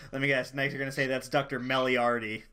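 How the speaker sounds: fast, sarcastic quip; quick quip. Acted. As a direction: rattle it off quickly. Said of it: "be like".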